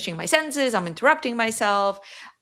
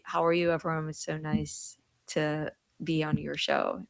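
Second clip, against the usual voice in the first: softer, lower polite English; softer, lower formal English greeting; bland English version; 4 semitones lower, 7 dB quieter than usual. Shown as a demonstration. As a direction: say it flat and proper, nothing to taste. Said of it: "whereas if I were to say it in English".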